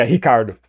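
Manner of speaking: Ricardo with R as a throaty H; Brazilian pronunciation. Shown as a demonstration. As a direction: start Ricardo deep in the throat, like an H. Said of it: "your throat makes a sound".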